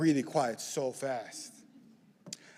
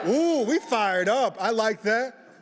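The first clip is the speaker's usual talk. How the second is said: high, slow, delighted cheer; fired up; 6 semitones higher, 5 dB louder, slower than usual. Real, not acted. Speaker to a cheering audience, thrilled and drawn out. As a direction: ride the crowd's energy, beaming. Audience finally answers loudly.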